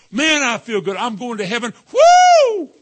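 high, loud gleeful whoop at the end; giddy churchgoer. Retold or imitated. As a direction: ham up a giddy new believer shouting woo. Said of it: "they just jumped in because".

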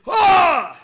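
high, loud sudden scream; imitated scream. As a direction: let out a startled demonic shriek. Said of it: "This guy just screams out".

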